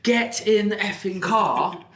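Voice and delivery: mock shout. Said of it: "you shout at them".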